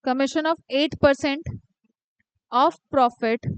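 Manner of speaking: slow dictation. Shown as a demonstration. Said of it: "dictating again".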